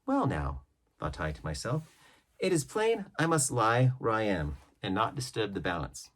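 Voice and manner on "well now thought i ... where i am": ordinary delivery, nothing marked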